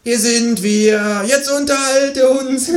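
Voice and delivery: very slow, high, commanding kangaroo. Acted. The kangaroo orders the artist to entertain, slow and high. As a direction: demand it slowly, high, then cackle.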